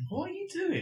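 high incredulous question; bewildered cry. Pretend. Host mocking the singing housemate, high and baffled. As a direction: act baffled at him.

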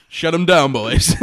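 mock FBI bust, shouted; mock raid shout. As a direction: shout it like an agent leading a raid. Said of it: "It's like the F B I".

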